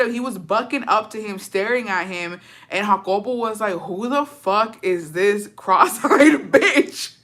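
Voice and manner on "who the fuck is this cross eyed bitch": mock indignant challenge breaking into laughter; laughing retelling. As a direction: draw it out slowly, cracking up on the insult. Retelling his challenge, cracking up.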